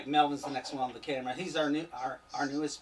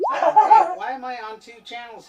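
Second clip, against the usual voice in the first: surprised; 7 semitones higher, 15 dB louder than usual. Real, not acted. Host surprised by double channel.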